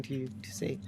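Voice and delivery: hushed whisper, half joking. Real, not acted. Friends whispering about the bad movie. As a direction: drop to a whisper.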